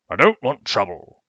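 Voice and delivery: put-on loud, nervous old gentleman; wary objection. Loud, worried refusal. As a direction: Josiah refuses trouble at the meeting. Josiah objects to keeping child.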